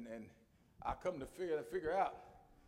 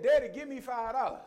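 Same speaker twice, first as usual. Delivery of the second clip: put-on a touch of a bossy child, higher; bossy daughter demand; 6 semitones higher, 7 dB louder than usual. Father retelling his daughter's demand, lightly bossy. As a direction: mimic the daughter ordering. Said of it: "She came to me and said".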